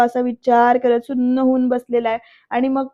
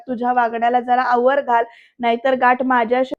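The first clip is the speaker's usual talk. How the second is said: usual speaking voice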